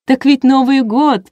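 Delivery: loud, high, drawn-out exclamation; excited chiming in. A narrator's reading. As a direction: proclaim it gleefully to the crowd. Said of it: "подхватила ведущая".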